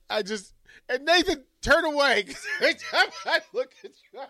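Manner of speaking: high-pitched talk dissolving into laughter; real giggles overtaking the words; cracking up. Real, not acted. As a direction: try to explain while giggling. Cannot stop laughing at co-host.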